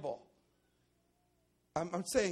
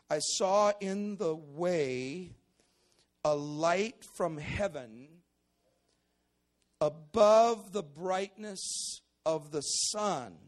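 slow scripture reading; 4 dB louder, much slower than usual. Acted.

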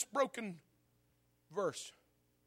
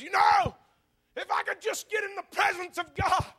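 high, pleading preacher's cry; desolate cry; 11 semitones higher, 4 dB louder than usual. Acted. Preacher voicing desolated Job seeking God, desolate cry. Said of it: "the cry of this desolated man".

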